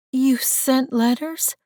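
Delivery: slow, high, incredulous question; stunned. Acted. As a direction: disbelief rising through the words. Stunned disbelief at news.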